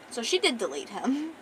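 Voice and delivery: plain reading voice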